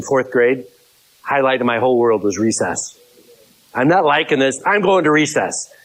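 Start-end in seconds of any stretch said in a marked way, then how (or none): 3.7-5.7 high schoolkid voice wanting out; childish whine. Pretend. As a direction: play a fourth grader heading to recess. Acting a fourth grader at recess.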